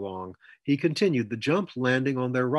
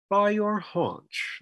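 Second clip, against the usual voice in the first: high, slow, relishing menace; menacing colonel; 9 semitones higher, much slower than usual. A narrator's reading. The colonel toys with Rollo, slow taunting menace. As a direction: stretch it out slowly, pitched up. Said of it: "the colonel amplified".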